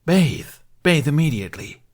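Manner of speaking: high, loud barked order; loud command. Acted. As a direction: command it, disgusted. Dragon demands a bath.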